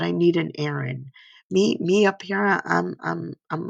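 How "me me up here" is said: ordinary talk, nothing marked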